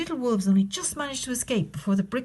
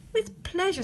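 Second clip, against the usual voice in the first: high flamingo; 11 semitones higher than usual. Acted. Flamingo replies.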